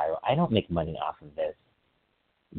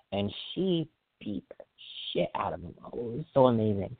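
ordinary talk

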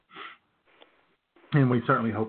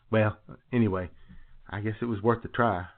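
ordinary delivery

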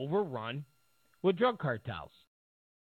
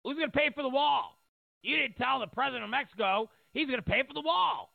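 mock indignant questioning; 9 semitones higher than usual. Mocking the press questioning Trump.

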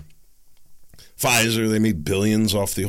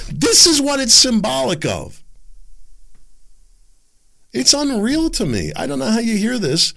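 loud, high rising fury; furious rant at the news; enraged; 13 semitones higher, 3 dB louder than usual. Real, not acted. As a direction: boil over in outrage. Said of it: "don't get enraged".

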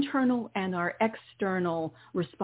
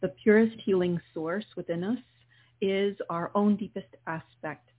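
guided meditation. Acted. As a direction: ease the listener into calm breathing. Leads a guided healing meditation.

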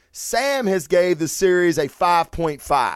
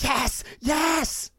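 high, far-off thrilled yes, much higher; giddy fan yelling; 10 semitones higher, 4 dB quieter than usual. Retold or imitated. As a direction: cheer like a giggling girl at her favourite scene. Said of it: "I'm like".

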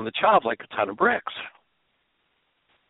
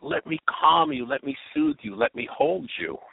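put-on soothing a baby; 11 semitones higher than usual. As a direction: croon it to calm a screaming child. Caring parent soothing crying baby.

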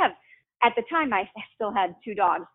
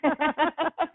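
bright laugh; 8 semitones higher than usual. Real, not acted. Laughing at the uncle story.

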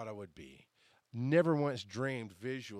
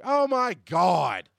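slow, drawn-out and much louder disbelief; exasperated oh my God; 6 semitones higher, 11 dB louder, much slower than usual. Real, not acted. Realizing Phil showed up, slow loud disbelief. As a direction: stretch it out in mock horror.

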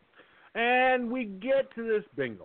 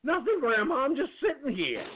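higher, innocent protest; high-pitched little denial; innocent grandson; 7 semitones higher than usual. Acted. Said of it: "sitting there twiddling my thumbs going".

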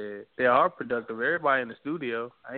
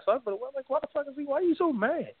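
incredulous high-pitched rant. Retold or imitated. Mocking an angry person, high.

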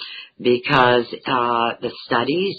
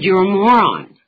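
mother repeats the insult louder and higher; 8 semitones higher, 9 dB louder than usual. Retold or imitated. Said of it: "She said".

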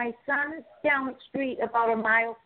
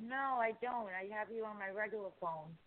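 muffled, distant phone line; 3 semitones lower, 12 dB quieter than usual. Real, not acted. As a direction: caller sounds muffled and far away. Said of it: "you sound muffled".

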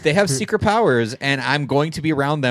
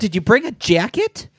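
high-pitched fussing question; 9 semitones higher than usual. Retold or imitated.

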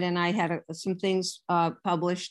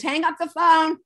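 high, loud call across the house; retold yell; yelling to the kids; 9 semitones higher, 5 dB louder than usual. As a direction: shout to the kids in the next room. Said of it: "I yelled to the kids".

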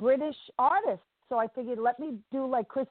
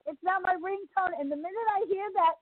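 normal talking voice

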